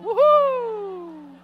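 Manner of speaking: high, loud excited whoop; kid's gleeful whoop. Retold or imitated. Little boy whooping after a backflip, high and loud.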